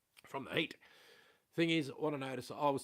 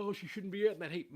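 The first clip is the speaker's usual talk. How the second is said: mocking mother-in-law; 8 semitones higher, 6 dB louder than usual. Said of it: "But she's like".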